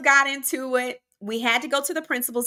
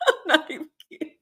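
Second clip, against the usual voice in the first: laughing; 3 semitones higher, much faster than usual. Real, not acted. Laughing at own story.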